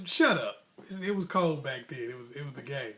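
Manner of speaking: normal talking voice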